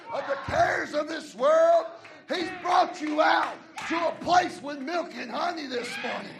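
loud, ringing sermon lines; preaching at full force. Acted. Pastor praising God's deliverance, preaching loud. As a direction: proclaim it to the church.

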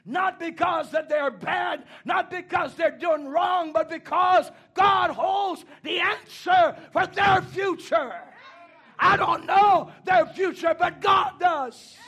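high, loud, drawn-out preacher's shout; full-force preaching. Acted. Preacher insisting God knows the children's future, full-force preaching. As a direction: belt it out to the whole congregation.

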